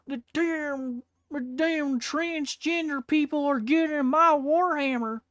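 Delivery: high, loud, slow whiny mock outrage; mocking an angry bigot. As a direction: parody a whiny bigot losing it over Warhammer. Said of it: "It's like".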